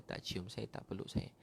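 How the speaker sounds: fading to a breathy near-whisper; softly voiced sorrow; hushed regret. Real, not acted. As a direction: let it die away to almost nothing. Mother not hugging him back.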